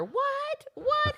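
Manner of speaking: very high hysterical mother squawk; mock shrieking. Missus Schwartz goes off on phone, mock shriek. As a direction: screech it like an outraged mom.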